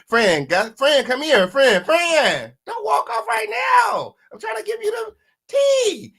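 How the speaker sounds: high shrieking call after someone walking off; mock frantic yell. Retelling the rapper's line to chat, high mock shriek. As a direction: yell up high, begging Fran to come back. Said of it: "he said, he said".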